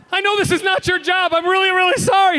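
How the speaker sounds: apologetic. Retold or imitated. Apologising after the kick.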